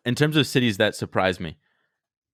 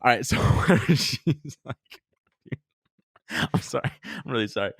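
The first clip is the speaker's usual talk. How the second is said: laughing; 4 semitones higher than usual. Real, not acted. Laughing fit mid-story.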